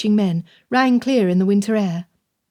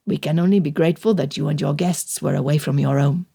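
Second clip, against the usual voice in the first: lower, a grave countryman's report; steady bad news, lightly voiced; burly local man; 4 semitones lower than usual. A narrator's reading. Jean-Paul warning Madame about runaway soldiers, grave and lower.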